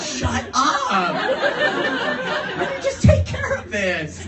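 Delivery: high, loud, laughing hush. Retold or imitated. Driver hushing friends before the cop, laughing. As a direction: hush them and take charge.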